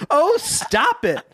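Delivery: high, drawn-out mock command; hammed-up order, stretched and high. A narrator's reading. Narrator plays Iola cutting Joe off, hammed-up command. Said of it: "Iola commanded".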